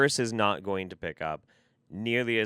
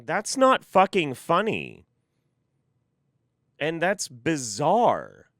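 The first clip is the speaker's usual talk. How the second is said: incredulous; 5 semitones higher, 4 dB louder, slower than usual. Real, not acted. Reacting to absurd story.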